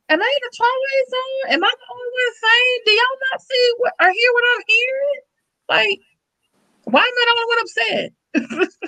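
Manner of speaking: very high-pitched, bewildered plea; exasperated disbelief. Acted. Asking people if she alone is upset, exasperated disbelief. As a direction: squeak it high, incredulous and upset.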